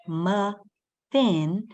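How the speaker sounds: "muffin" as muh, pause, fin; split into syllables. Shown as a demonstration. Teacher recalls jaw dropping two times, saying "muffin" in two separate syllables. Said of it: "When I said the word".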